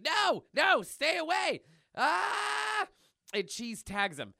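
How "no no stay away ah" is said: high, hammed-up cartoon terror; mock-frightened yelp rising to a scream; panicked escape. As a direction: cry out as if fleeing a pursuer. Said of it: "Milk is trying to get away".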